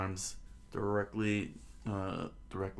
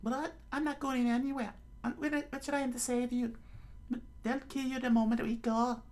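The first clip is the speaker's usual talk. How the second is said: put-on quick high falsetto girl voice; hurried falsetto refusal; Nami; faster than usual. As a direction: protest rapidly in a squeaky female voice. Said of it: "I think that's Nami's talking".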